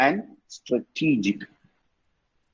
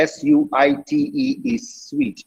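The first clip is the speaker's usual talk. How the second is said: ordinary talk, nothing marked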